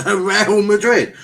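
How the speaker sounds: laughing. Real, not acted. Mocking rival's lost final.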